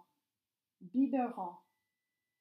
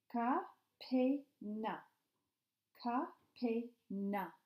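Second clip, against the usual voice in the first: sounding out syllables; much slower than usual. Shown as a demonstration. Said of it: "Écris chaque mot en mettant ... les syllabes en ordre".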